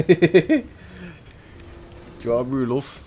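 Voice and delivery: hammed-up northern mum fussing over a brew, "brew" leaned on; Manchester mum. Voicing a caring Manchester mum.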